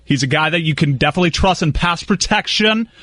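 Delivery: normal talking voice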